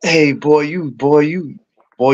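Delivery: normal talking voice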